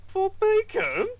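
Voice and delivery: very high, slow disbelieving question; incredulous. Acted. Boss hears of the kidney trade, incredulous. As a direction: squeak it out in disbelief.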